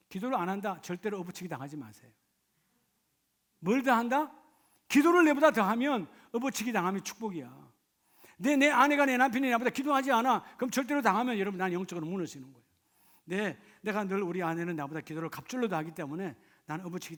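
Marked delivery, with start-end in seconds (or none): 3.6-12.7 loud, high, fervent preaching; full-force preaching. Acted. Pastor pleading with the congregation, loud fervent preaching.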